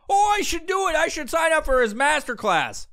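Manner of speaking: high, gushing mock excitement; mock eagerness. Gullible fan signing up for masterclass, high mock excitement. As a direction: gush like an easily sold fan. Mocking everybody's eagerness to sign up.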